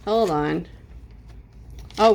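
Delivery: ordinary talk, nothing marked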